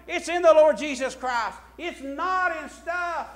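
loud, rising preacher's cry; full-throated sermon climax; preaching at full force. Acted. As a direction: preach it out to the congregation.

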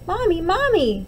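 put-on high, scared child calling out for mom; frightened cry. Woken twins cry out to Mommy in fright. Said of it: "they cried out".

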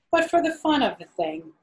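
usual speaking voice

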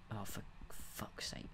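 slow whispered swearing; hushed, drawn-out exasperation; muttered curse. Real, not acted. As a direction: curse wearily under your breath. Cursing after mishap.

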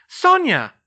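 high, loud, delighted cry; loud greeting that falls from high; exclaimed. A narrator's reading. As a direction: exclaim the name on greeting a friend. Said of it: "Hermione exclaimed".

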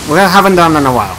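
nervous stutter, rushed and loud. Real, not acted. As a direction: trip over the words nervously. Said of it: "I'm stuttering already".

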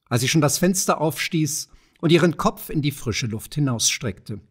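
plain reading voice

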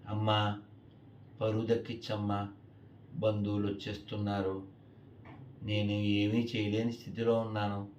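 soft, low prayer of a helpless mother; imitated tearful pleading. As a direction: plead quietly with the goddess, lightly acted. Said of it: "ఆవిడ ఏడుస్తూ సీతమ్మ వారిని వేడుకుంటుంది". (ఏడుస్తూ వేడుకుంటున్నట్లు, మెల్లగా)

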